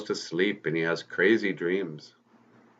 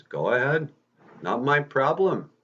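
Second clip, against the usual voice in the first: higher-pitched, acted indifference; dismissive; 7 semitones higher than usual. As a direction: shrug it off in character. Peter shrugs off the fleeing robber.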